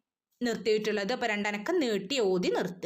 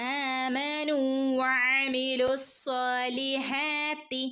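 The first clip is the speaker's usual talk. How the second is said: slow chanted ഇന്നല്ലദീന; recitation; 3 semitones higher, much slower than usual. Shown as a demonstration. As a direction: recite it slowly, nasal held. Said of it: "രണ്ടനക്കം നീട്ടി ഓതി". (സാവധാനം ഓതുക)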